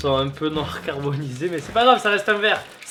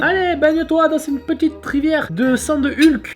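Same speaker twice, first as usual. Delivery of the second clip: playful high voice; 9 semitones higher, 6 dB louder than usual. Pretend. Talking to chip in water.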